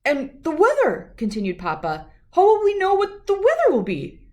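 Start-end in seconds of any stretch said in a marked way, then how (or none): 0.0-1.1 protesting Papa. Acted. Papa keeps protesting to Mama. 2.3-4.3 high, loud worried outburst; Papa in dismay. Acted. Papa protesting to Mama he won't know the weather, high and loud.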